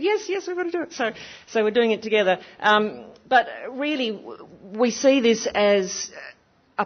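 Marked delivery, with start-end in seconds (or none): 0.0-0.9 high, quick keen "Yes, yes"; imitating a friend's keen agreement; eager agreement. Anne-Marie agreeing after the speech, high eager voice. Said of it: "And she said".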